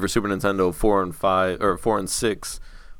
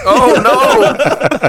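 much higher, louder hammed-up dismay; mock horror; 8 dB louder than usual. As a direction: cry out in fake alarm. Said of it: "imagine him at a family gathering".

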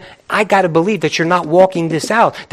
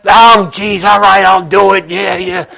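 grudging complaint. Retold or imitated. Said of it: "No complaint".

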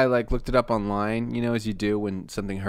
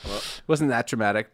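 ordinary talk

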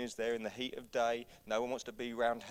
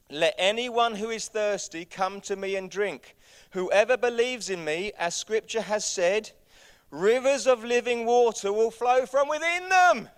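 raised, proclaiming voice building to a peak; loud voice; 8 semitones higher, 11 dB louder than usual. Acted. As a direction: proclaim it as Jesus calling to the crowd. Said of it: "said in a loud voice".

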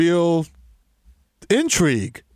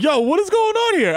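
higher, astonished retold question; retold disbelief; 11 semitones higher than usual. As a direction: sound stunned at the scene. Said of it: "I was just like".

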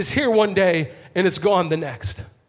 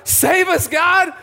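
high, full shout of desperate pleading; crying out; 10 semitones higher, 5 dB louder than usual. Acted. Festival crowd asking God where he is, crying out. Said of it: "They're all crying out".

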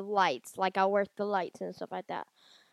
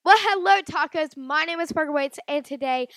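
high, loud host opening; YouTube intro; 5 semitones higher, 5 dB louder than usual. Acted. Vlogger greeting viewers under speech jammer, upbeat host intro. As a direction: open the show like a channel host. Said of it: "I'm gonna do my intro for YouTube".

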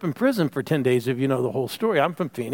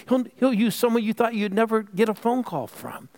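high, ringing preacher's call; preaching at full force; 7 semitones higher than usual. Real, not acted. Pastor rousing the congregation to repeat, preaching fervently. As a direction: lift the congregation, preaching hard.

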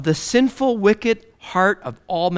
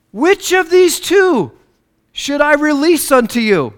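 Pilate; 7 semitones higher, 4 dB louder than usual. Acted. Pilate offers the crowd a choice of prisoner, raised proclaiming voice. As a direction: put the choice to the crowd, pitched high. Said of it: "And so Pilate says".

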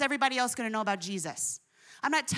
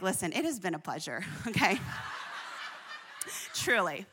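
soft, softer words breaking into a laugh; laughing while quitting; 5 semitones lower, 7 dB quieter than usual. Real, not acted. Quitting the brand job with a real chuckle. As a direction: let the laugh slip through the polite goodbye.

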